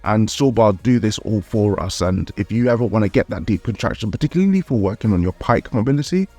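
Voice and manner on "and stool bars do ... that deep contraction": ordinary talk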